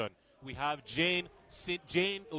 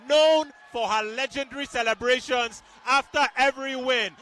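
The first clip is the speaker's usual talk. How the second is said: higher, louder hyped play-by-play; excited commentary; 7 semitones higher, 7 dB louder than usual. Real, not acted. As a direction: celebrate the knockout on air.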